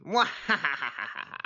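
slow, high mwahaha; evil villain laugh. Acted. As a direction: cackle like a cartoon villain.

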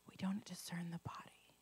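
breathy whisper of the verse; hushed, intimate repeat. Shown as a demonstration. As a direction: whisper it like a secret. Repeats scripture phrase, whispered take.

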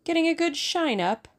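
high, chirpy nurse greeting the patient; bright hospital nurse style. A narrator's reading. Tuppence breezily greeting the boy, high and cheery nurse style. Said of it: "in the best approved hospital early morning style".

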